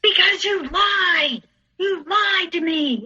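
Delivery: high, drawn-out accusing yell; retold screaming. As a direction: imitate an angry boyfriend wailing each word. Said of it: "he stopped screaming".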